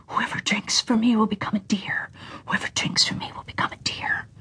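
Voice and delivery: soft eerie whisper fading away; spring's whisper. A narrator's reading. Third spring whispers, fading to almost nothing. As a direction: breathe the curse like the water.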